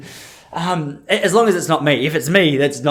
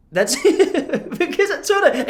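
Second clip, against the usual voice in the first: laughing; 13 semitones higher, much slower than usual. Real, not acted. Laughing at own joke.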